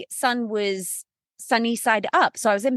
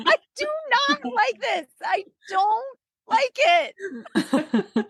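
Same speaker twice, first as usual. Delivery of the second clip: mock wail; 14 semitones higher, slower than usual. Repeating her labor wail.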